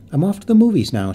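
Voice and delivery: calling out loud from the doorway; called out. A narrator's reading. Sister calling from the door, loud. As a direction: call it through the open door. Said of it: "she called out".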